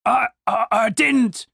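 tripping over the first word in alarm; nervous stammer. A narrator's reading. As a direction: stammer it like a soldier caught out. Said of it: "Yellowteeth stammered".

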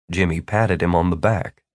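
usual speaking voice

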